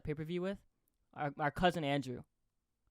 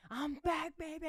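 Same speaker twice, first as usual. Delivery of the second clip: higher, pumped-up mock promo; wrestler's return line; 11 semitones higher, 4 dB quieter than usual. A hint of the wrestler greeting the crowd, pumped up. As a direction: hype it like a returning star. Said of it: "and then he goes".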